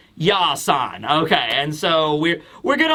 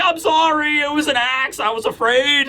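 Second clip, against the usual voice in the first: high, pleading sorry; squeaky apologetic giant; whiny apology; 7 semitones higher than usual. Retold or imitated. Road apologizing to Nami, high whiny. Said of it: "It's like".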